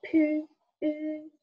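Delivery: "pu-, u" high, the rounded vowel held; Danish Y sound. Shown as a demonstration. Dane teaching the letter Y, held vowel. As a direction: isolate the vowel in "pu-, u", stretched and high. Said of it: "so the Y, we say".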